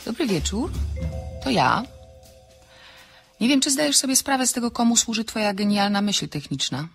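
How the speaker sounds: ordinary delivery, nothing marked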